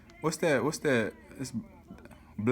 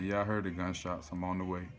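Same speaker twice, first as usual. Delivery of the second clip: softer, chill reply; a hint of a laid-back friend; calm friend; 3 semitones lower, 7 dB quieter than usual. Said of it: "He was calm too".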